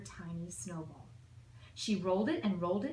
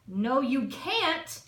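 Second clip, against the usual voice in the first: louder and slow, a hint of a booming aunt; big blustery voice; 6 semitones higher, 6 dB louder, slower than usual. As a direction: lean on it slowly like a bossy grown-up. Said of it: "had big blustery voices".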